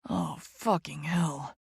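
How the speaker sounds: slow low groan of desire. A narrator's reading. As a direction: groan the words out. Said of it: "he groans".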